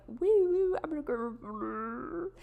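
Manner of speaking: high wailing mock police siren; grammar police siren. Mocking herself as the grammar police, siren-like wail. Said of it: "being the grammar police here. Like".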